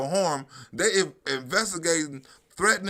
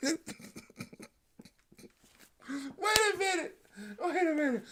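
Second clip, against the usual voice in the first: laughing; 9 semitones higher, 10 dB quieter, faster than usual. Real, not acted. Laughing after the clip.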